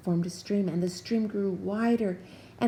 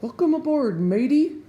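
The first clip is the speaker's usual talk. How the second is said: loud, high sailor's welcome; hearty shout; 7 semitones higher, 10 dB louder than usual. A narrator's reading. The captain welcoming Jubal aboard, hearty loud shout. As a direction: boom it out like an old salt. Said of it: "Captain Dalbert shouted".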